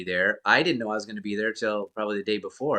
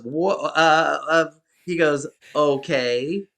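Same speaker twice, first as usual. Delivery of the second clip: mock stammering surprise, pitched up; louder, halting double take; taken aback; 5 semitones higher, 6 dB louder, slower than usual. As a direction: act briefly lost for words. Retold stunned host, caught off guard.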